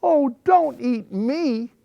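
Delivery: high, loud, drawn-out plea; high-pitched storybook begging; little goat pleading. A narrator's reading. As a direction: cry out slowly as a small scared goat. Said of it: "cried the little Billy Goat Gruff".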